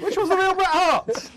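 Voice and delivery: high, slow quiz host; game show host voice. Acted. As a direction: ham up a quiz host question.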